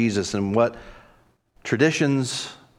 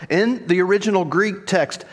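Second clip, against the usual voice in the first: usual speaking voice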